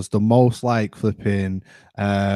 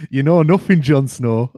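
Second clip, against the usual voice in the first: louder, higher repeat of a catchphrase; mock Northerner; 4 semitones higher, 7 dB louder than usual. Teasing a co-host as Jon Snow, louder mock Northerner. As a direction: push the quote up high and loud. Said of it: "you sound like one of the Northerners".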